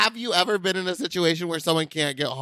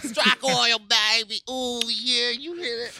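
higher, hammed-up hillbilly accent; mock hillbilly accent; 6 semitones higher, slower than usual. Cohost mimics the caller's bedroom line in a mock hillbilly accent. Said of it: "In the accent?".

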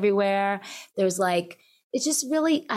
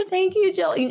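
laughing; 11 semitones higher than usual. Real, not acted. Laughing while thanking.

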